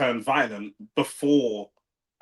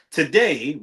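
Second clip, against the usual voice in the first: ordinary talk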